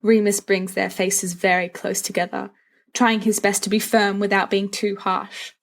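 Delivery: usual speaking voice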